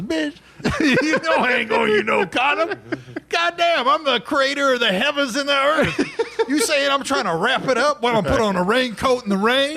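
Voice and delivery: high-pitched God, laughing through; squeaky streetwise God, laughing. Acted. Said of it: "he goes".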